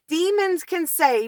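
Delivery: plain reading voice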